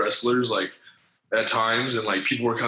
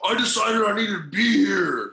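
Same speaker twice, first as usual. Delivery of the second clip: grand re-entrance; 11 semitones higher, 7 dB louder than usual. Retold or imitated. Wrestler dramatically returns.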